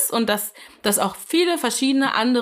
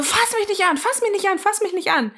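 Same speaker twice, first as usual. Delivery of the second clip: high, repeated mock cry; mock panicked protest; 9 semitones higher than usual. Retelling a woman quarrelling with her partner, high mock protest. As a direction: imitate a woman frantically warding someone off.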